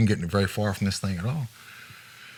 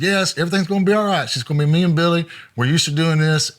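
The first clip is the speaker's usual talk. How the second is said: eager reassurance; 6 semitones higher, 3 dB louder, faster than usual. Retold or imitated. Reassuring the theater on phone.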